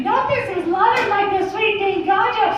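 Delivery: high, sing-song mascot voice with a giggle; cutesy high-pitched mascot falsetto; Pillsbury Doughboy. Acted. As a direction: pipe it up high, end on a squeaky giggle. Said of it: "that's the fucking Pillsbury Doughboy".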